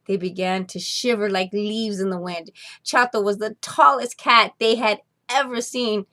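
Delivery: plain reading voice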